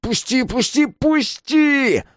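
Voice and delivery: very high enraged shriek; angry squealing. A narrator's reading. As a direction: squeal in fury to be let go. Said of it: "завизжал, но не от боли, а от гнева".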